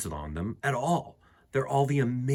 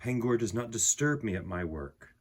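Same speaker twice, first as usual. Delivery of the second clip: calm, softer read-aloud voice with unhurried pauses; gentle poem reading; 4 semitones lower, 4 dB quieter than usual. A narrator's reading. Reading the picture book poem to listening children, calm and soft. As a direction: read it like a quiet bedtime poem.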